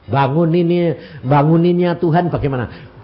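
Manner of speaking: normal talking voice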